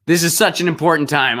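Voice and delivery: hammed-up earnest plea; mock earnestness. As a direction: parody a star's grave concern. Mocking [name]'s political plea.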